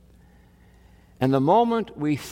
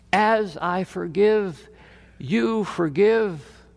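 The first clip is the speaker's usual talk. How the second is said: slow emphatic quote; 5 semitones higher, slower than usual. Acted. Jesus's words, drawn out.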